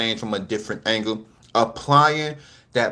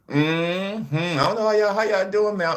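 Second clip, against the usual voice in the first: high, far-off sing-song hello; high playful hey y'all; sing-song greeting; 9 semitones higher than usual. Real, not acted.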